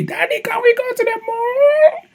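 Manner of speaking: put-on very high, slow whiny kid voice; child's voice. Father imitating his child asking to go out, very high whiny voice. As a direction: whine like a little child asking dad. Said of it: "he even call me".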